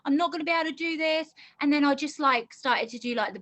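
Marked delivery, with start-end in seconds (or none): 0.0-1.3 higher, rushed panic; lying awake at four in the morning, panicking about the shop; panicky thoughts. Retold or imitated. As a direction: let the panic rise in the pitch. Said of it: "panic mode".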